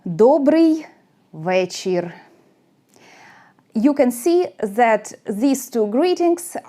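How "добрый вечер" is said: careful teacher model of добрый вечер; Ukrainian pronunciation demo. Shown as a demonstration. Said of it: "In Ukrainian is".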